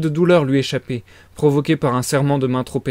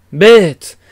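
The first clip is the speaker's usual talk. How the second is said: much louder repeated bête; scornful shout; 3 semitones higher, 8 dB louder than usual. A narrator's reading. Razumikhin denouncing the fiancé, shouting bête. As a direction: spit the second bête out loud.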